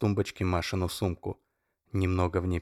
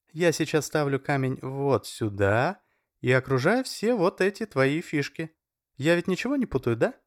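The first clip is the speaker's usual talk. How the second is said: Masha's voice; 7 semitones higher, 3 dB louder than usual. A narrator's reading. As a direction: sweetly point out the move. Masha voiced higher in story.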